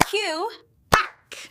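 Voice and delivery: high duck-call voice; duck quack. Shown as a demonstration. Teacher showing children what a duck says, high quack. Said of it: "A duck says".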